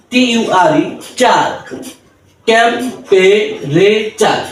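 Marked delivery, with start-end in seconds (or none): 2.5-4.4 temperature drawn out, syllables apart; slow syllable by syllable. Shown as a demonstration. Tutor to learners, slow broken-up temperature. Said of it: "next word".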